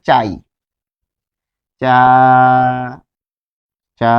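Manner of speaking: চা held out, then repeated after pauses; teacher drawing out চা; syllable drill. Shown as a demonstration. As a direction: stretch চা long and repeat it. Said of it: "একটি শব্দ লিখবো".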